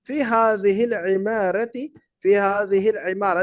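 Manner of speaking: فی ھذہ العمارة read slowly, words separated; slow model reading. Shown as a demonstration. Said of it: "ٹین کو پڑھتے ہیں". (آہستہ ماڈل پڑھائی)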